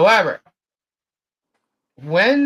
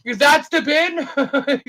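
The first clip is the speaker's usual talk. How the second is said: laughing retold question; 9 semitones higher, much slower than usual. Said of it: "retold husband question, laughing".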